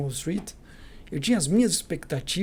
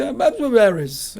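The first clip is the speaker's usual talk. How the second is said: a louder, higher, half-irritated repeat of the name; imitating an annoyed caller; 5 semitones higher, 6 dB louder, much slower than usual. Caller repeating her name to the intern, mock annoyed. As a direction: bark the name back, fed up at having to repeat it. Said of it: "Ela, meio brava".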